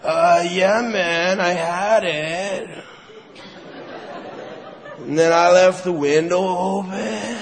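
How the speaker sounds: low, slow, spaced-out mumble; junkie excuse. Acted. Junkie explaining where the money went, low and slow. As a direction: drag out a lame excuse like a stoner. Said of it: "I'm like ... junkie".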